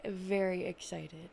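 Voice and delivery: ordinary talk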